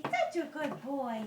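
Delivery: ordinary talk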